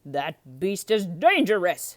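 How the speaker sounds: loud slow shout; Gaston shouting. A narrator's reading. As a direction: warn the crowd loudly. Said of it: "shouted Gaston".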